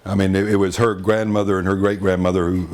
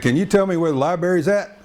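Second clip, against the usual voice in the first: high hailing country Texan; 8 semitones higher than usual. Retold or imitated. Scene: Texan calling to a Harvard guy, bumpkin hail. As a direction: call out to him like a country bumpkin. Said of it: "hails this Harvard guy".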